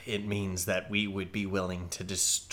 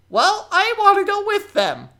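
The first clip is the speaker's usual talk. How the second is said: put-on much higher, louder kid-goblin pleading; young goblin voice; 11 dB louder than usual. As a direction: squeak it out like an eager young goblin.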